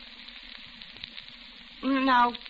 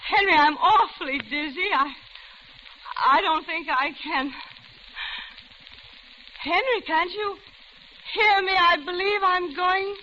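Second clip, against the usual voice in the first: rising in pitch, pleading as she feels faint; frightened woman realising she's been drugged, voice climbing; growing panic; 7 semitones higher than usual. Acted.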